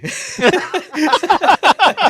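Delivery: loud high burst of laughter. Real, not acted. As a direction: crack up at own story. Funny story punchline.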